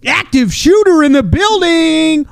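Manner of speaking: slow, loud announcer call; mock announcer. Pretend gym announcer over the PA, booming. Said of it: "be the fucking announcer".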